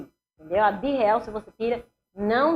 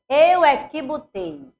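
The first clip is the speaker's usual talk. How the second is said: louder, stress on "eu é que" in "eu é que botei"; emphatic claim; 5 semitones higher, 7 dB louder, much slower than usual. Retold or imitated. Boss asserting rank to a subordinate, defiant emphasis on "eu é que". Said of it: "eu boto assim".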